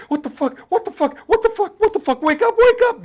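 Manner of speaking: terrified Nancy screaming wake up; panicked screaming, building. Retold or imitated. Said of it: "her just being like".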